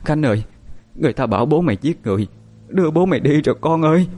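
lightly voiced sobbing mother; mother wailing that father was taken; crying loudly. A narrator's reading. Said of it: "bà cố lại càng khóc to".

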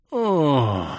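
guttural groan of pain; deep groan. A narrator's reading. Krey curled up after the crash, guttural groan. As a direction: groan from the gut. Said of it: "утробно застонал Крей".

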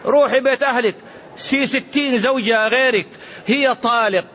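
imitating an angry husband driving his wife out; mock angry husband. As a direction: play the hasty husband lashing out. Hasty husband shouting at wife.

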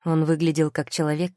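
plain reading voice

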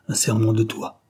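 ordinary delivery, nothing marked

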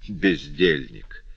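slow, low scornful drop of the word; tossed off. A narrator's reading. Officer dismissing his cousin, slow scornful aside. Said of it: "бросил Теодюль".

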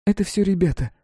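put-on high, small girl's voice; quiet girl. A narrator's reading. Rescued girl answers the narrator's question, quiet and high. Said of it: "тихо отозвалась девчонка".

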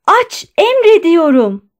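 high, loud, drawn-out command; shouted order. A narrator's reading. Doctor commanding the guard to open the door, shouting. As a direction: order it desperately at full voice. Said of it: "diye bağırdı".

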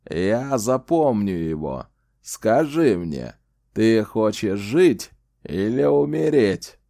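put-on slow, menacing old wizard; sinister threat. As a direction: lean in and threaten, slowly.

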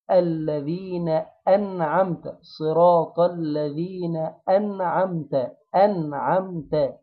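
الذين أنعمت with a smile, thin نون and a clear glottal stop on أنعمت; smiling recitation. Shown as a demonstration. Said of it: "على هيئة المبتسم".

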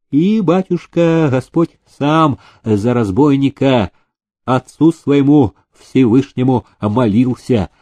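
ordinary delivery

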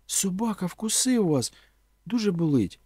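high, worried exclamation; alarmed concern. A narrator's reading. Woman to the man a dog just bit, alarmed. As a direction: raise the pitch in concern.

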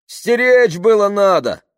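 high, loud, drawn-out yell; shouted reproach. A narrator's reading. Crowd member blaming Tamara for the theft, shouted. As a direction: heckle accusingly from the crowd.

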